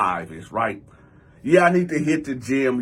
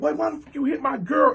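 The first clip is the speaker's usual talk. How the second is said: high indignant question; mock outrage; 8 semitones higher than usual. Cousin demands why his girl was hit, high protest. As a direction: imitate an upset cousin, pitched up.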